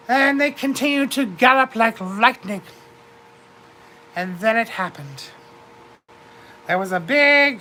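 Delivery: ordinary delivery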